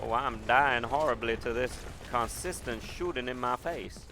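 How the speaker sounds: theatrical put-on Southern accent; Southern belle accent. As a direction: keep the Southern belle going through disaster. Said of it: "with this Southern accent".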